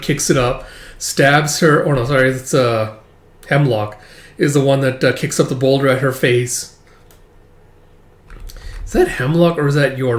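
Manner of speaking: ordinary talk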